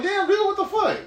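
very high-pitched amused disbelief; mock shock. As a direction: squeal it as a stunned reaction to a wild confession. Acted shock at the old man's confession.

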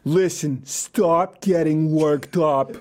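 slow, drawn-out New York mobster parody; fifties movie mobster. Acted. Host parodies New York brothers telling critics off, mobster voice. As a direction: lay it on thick like a film gangster. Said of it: "parody of ourselves from a fifties mobster movie".

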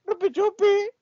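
high squeaky ghost cry. Acted. Ghost noise in radio sketch. (voz de fantasma aguda)

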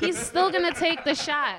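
high, loud and slow; loud insisting. Real, not acted. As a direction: insist over the laughter. Insisting loudly.

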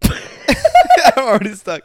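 high, loud laugh through the words; real helpless laughter. Real, not acted. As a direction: crack up at being stuck. Laughing at failing the quiz.

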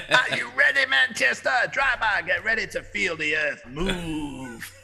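loud, high-pitched crowd-pumping shout; hype man. Acted. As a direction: pump up the crowd like a gig MC. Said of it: "I should be a hype man".

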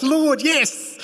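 high, excited Lord yes; starving man's happy shout; eager cry. Retold or imitated. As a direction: jump at the food with delight. Peter hungry sees food arriving.

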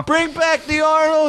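very high, drawn-out crowd cry; squealed, stretched-out heckle; mock heckler. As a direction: call out like a pestering audience member. Acting out heckling audience calling out.

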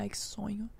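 soft unvoiced whisper of relief. Retelling the moment her baby fell asleep, hushed whisper. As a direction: breathe it so the sleeping baby stays asleep.

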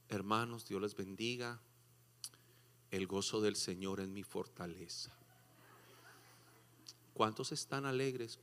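low, soft, lifeless church drone; mock dull preacher. Mocking a joyless preacher greeting the church, flat and drowsy. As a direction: imitate a bored preacher with no joy.

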